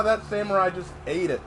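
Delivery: normal talking voice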